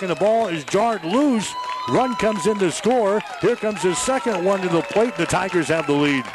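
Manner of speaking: excited baseball radio call; live play-by-play. Real, not acted. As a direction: call the action as it happens.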